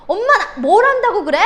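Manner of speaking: high, loud snapping at mom; acted angry yelling. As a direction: lash out as a daughter taking it out on her mother. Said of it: "엄마한테 소리를 칩니다". (엄마에게 버럭 소리치는 딸 연기)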